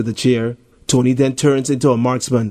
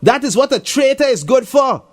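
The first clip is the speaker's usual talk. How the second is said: put-on gloating boy; 12 semitones higher than usual. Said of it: "Jason says as he stands over Darren".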